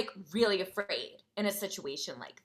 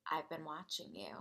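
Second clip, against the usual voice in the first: very soft, lower-pitched voice; hushed creepy quote; 5 semitones lower, 13 dB quieter than usual. Retold or imitated. Girl telling narrator she is watching, hushed and creepy. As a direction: imitate a stalker, barely audible. Mysterious girl's quote in story.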